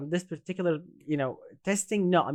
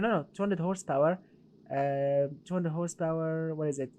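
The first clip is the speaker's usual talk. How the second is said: ordinary talk, nothing marked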